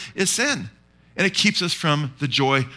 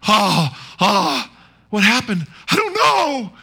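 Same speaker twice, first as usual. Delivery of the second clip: distressed whine; 5 semitones higher, 4 dB louder, slower than usual. Acted. Preacher acting out a worried believer for the church, hammed-up whine. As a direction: pitch jumping much higher and louder, a whiny helpless cry.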